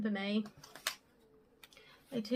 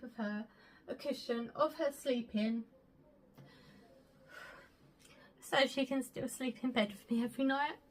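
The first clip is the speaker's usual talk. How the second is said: tearful, fighting back sobs; crying; 3 semitones higher than usual. Real, not acted. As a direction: voice breaking through tears. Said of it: "as much as I'm crying about it".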